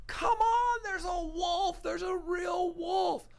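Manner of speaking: high, slow-drawn shout for help; desperate yelling. Acted. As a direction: call the villagers at full volume. Boy desperately calls villagers for help.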